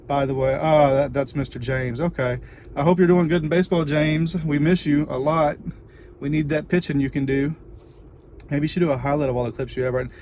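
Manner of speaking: normal talking voice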